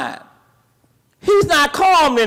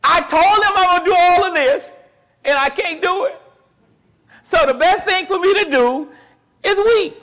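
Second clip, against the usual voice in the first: lightly voiced Jesus, a touch grieving; a slightly raised plea that he must weep; voicing Jesus in grief; 6 semitones higher than usual. Preacher voicing Jesus to the congregation, a touch grieving. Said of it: "and he got emotional".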